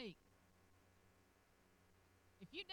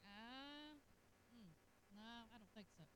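soft, lowered, hesitant dismissal; doubtful musing; 5 semitones lower, 12 dB quieter than usual. Acted. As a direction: hum over it, then quietly turn it down. Acted doubtful reaction to what he hears.

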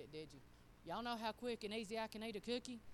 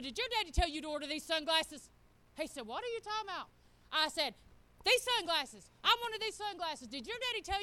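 louder, higher, demanding answers; excited loud accusing; 4 semitones higher, 9 dB louder than usual. Retold or imitated. Mom on the phone grilling her son, loud and demanding. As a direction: press him hard, voice raised and high.

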